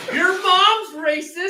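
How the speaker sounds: high, loud, drawn-out comeback; mock taunt. Buddies trading a silly insult, playful taunt. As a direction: throw it back high and slow as a silly jibe.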